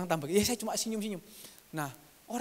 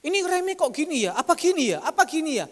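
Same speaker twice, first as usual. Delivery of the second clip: high, louder fretting questions; mock worry; 7 semitones higher, 6 dB louder than usual. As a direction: fret over the brakes like a nervous customer. Anxious driver at the mechanic. (pemilik mobil cemas di bengkel, khawatir dibuat-buat)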